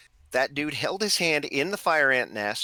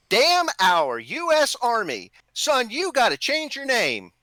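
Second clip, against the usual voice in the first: higher and louder, slow on the first part, a mock drill sergeant; drill sergeant bark; 9 semitones higher, 6 dB louder than usual. Drill sergeant poking a recruit about his name, mock bark. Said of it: "and he said".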